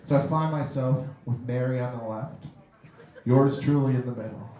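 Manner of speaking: ordinary delivery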